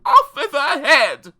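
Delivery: slow, loud, high royal command; drawn-out theatrical bellow; roared. A narrator's reading. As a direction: roar the order as an outraged prince. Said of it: "the prince roared back".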